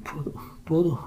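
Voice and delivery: soft, high, barely there plea; faint voice. A narrator's reading. Bride pleading faintly with her husband, soft and high. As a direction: beg him to stop, almost no breath. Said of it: "மெல்லிய குரலில்".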